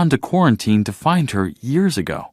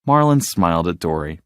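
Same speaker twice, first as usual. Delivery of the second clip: ordinary delivery, nothing marked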